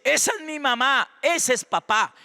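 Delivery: high, lightly acted little child; child's voice. Small child hearing the parents talk, imitated high child voice. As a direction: pipe up like a child recognizing parents. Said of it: "el niño dice".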